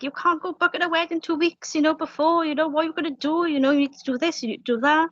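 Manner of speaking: imitated exasperated mother, voice up high; furious mum. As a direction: play mum's high-pitched panicked telling-off. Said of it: "furious because she was like".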